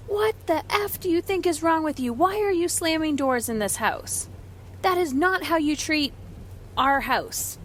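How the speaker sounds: high-pitched scolding, not truly shouted; mock angry scream. Old self scolds son for slamming doors, mock screaming. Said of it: "I would have screamed at him".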